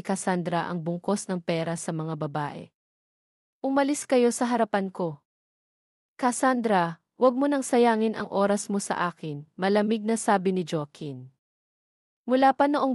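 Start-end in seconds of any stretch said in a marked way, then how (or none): none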